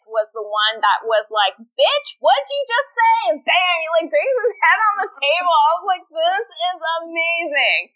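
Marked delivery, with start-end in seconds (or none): 1.6-3.1 high, loudish mock outrage; incredulous, pitched-up challenge; confrontational challenge. Voices man confronting an insult. 6.1-7.9 high, drawn-out gushing; retold fangirl excitement, words stretched out; thrilled delight. Retells own delight at fight scene.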